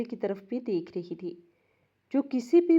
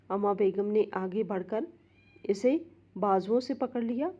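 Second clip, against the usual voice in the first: plain reading voice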